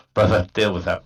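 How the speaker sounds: ordinary talk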